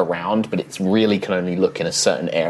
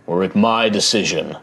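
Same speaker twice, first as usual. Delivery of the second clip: deep rumbling voice; 3 semitones lower than usual. Acted. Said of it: "this deep rumbling voice".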